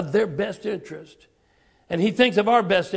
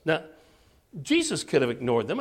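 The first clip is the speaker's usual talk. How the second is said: ordinary delivery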